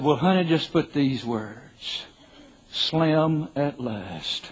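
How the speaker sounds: slow, stretched-out punchline; deadpan joke. Retold or imitated. Husband jokes to wife about his epitaph, slow deadpan. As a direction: draw out the epitaph slowly for the laugh.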